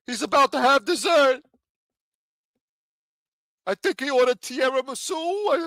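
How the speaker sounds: high, put-on voice of a tipster calling it in; high mock informant voice. Mocking the arrested man, he plays a snitch calling police. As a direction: sneak it to the cops like a gossiping snitch. Mock tip-off call about a mobster.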